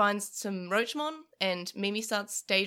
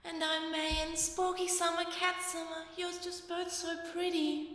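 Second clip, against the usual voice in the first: high, airy, sugary sweet; dreamy distant voice; 9 semitones higher, 3 dB quieter than usual. Pretend. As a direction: gush like you're in a dream. Sugary daydream fantasy scene.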